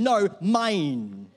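loud mock-toddler refusal; possessive toddler. Preacher likens believers to his toddler, loudly possessive. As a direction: dig in like a kid guarding a toy. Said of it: "we're like Amara's".

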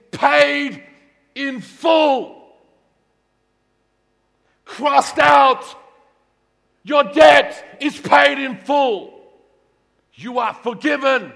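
loud, high shout, slow and drawn out; shouted proclamation. Acted. Pastor shouting forgiveness to the church, very slow. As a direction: shout the good news like a victory cry.

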